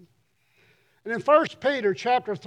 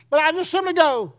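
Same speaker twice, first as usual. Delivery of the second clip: ordinary delivery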